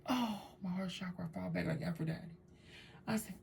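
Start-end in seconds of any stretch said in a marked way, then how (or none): 0.1-2.2 swooning sigh. Retold or imitated.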